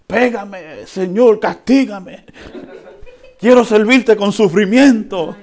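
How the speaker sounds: high, loud pleading cry to the Lord; mock masochist plea. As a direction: ham up a believer begging for punishment. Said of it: "que les gusta".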